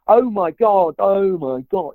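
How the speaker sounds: lightly imitating David Tennant's broad British accent. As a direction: ham up an over-broad English accent. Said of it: "he's so broad with it".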